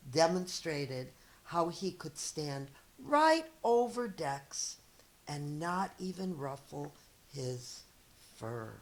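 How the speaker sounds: lively read-aloud storyteller voice; story narration. Acted. Teacher reading a picture book to her students, lively storytelling. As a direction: read it to young kids, expressive and clear.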